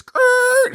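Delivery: high screeching record-scratch noise. Acted. Diner stops at word of a vegetarian, record-scratch screech. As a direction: imitate a needle skidding off a record. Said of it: "like the record scratches".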